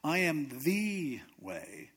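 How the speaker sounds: slow, with "the" said as a long "thee"; stress on THE. Shown as a demonstration. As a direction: stretch "the" into "thee" to mean the only one. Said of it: "That means everything else is not".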